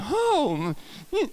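high, swooping "oh"; mock swooning gasp. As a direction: act out a sudden rush of feeling. Acts out the sudden feeling.

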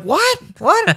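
high-pitched mock disbelief; incredulous. Family hearing about the coffee, incredulous. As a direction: squeak it out, astonished.

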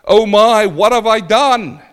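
high, loud anguished cry; dying man's terror. Retold or imitated. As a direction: cry out in horror at the end. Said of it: "he said".

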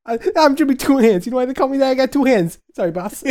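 very high, loud annoying mafia rookie; Jimmy Two Hands. Acted. Rookie explaining his nickname to the boss, annoyingly loud. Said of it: "This is how he talks".

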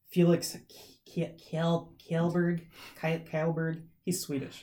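put-on Swedish accent. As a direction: try Kjellberg in a Swedish accent. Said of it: "that was my best Swedish, my Swedish accent".

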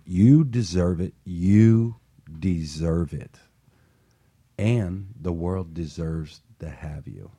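usual speaking voice

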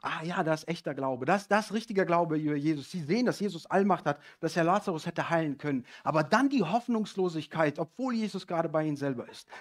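ordinary delivery

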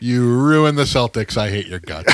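hammed-up angry fan rant; mock fan heckle. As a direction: jeer like a bitter fan in the stands. Imitating angry Celtics fans.

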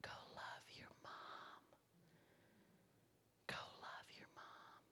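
soft unvoiced whisper, slow; whispered voice of God. God quietly telling her to love her mom, whispered. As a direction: breathe it like a gentle divine whisper. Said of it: "You know what God said?".

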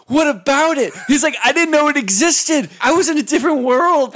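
loud, high mock-indignant protest; exasperated retort. Imitating drunk friend asked about the bathroom, loud exasperated retort. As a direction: protest wildly, pitch pushed way up.

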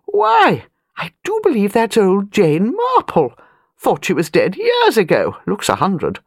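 put-on a startled high "Why" sliding into a gossiping old lady; surprised old aristocrat spotting a friend; lightly acted exclamation of surprise; exclaiming.

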